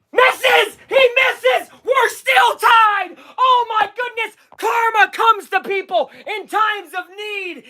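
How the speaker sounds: high-pitched excited shouts; elated shouting. Real, not acted. Fan streamer celebrating a missed free throw, elated. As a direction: shout in gleeful relief.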